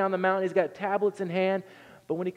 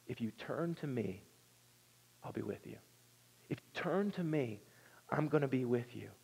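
soft tender promise; 3 semitones lower, 7 dB quieter than usual. Acted.

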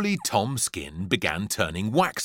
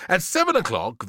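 ordinary delivery, nothing marked

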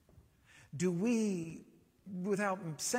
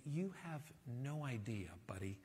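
soft, dropping to a whisper on buddy; a hint of a confiding Jesus; lightly voiced knowing aside, fading quiet; knowing hush; 3 semitones lower, 7 dB quieter than usual.

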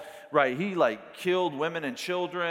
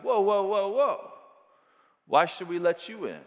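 gate guard stopping you; 5 semitones higher than usual. Pretend. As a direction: stop the fan like a stern usher. Stadium guard stopping someone.

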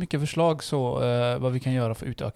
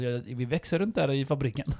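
ordinary talk, nothing marked

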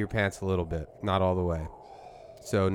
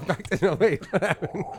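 laughing; 8 semitones higher, 3 dB louder than usual. Real, not acted. Breaks up laughing.

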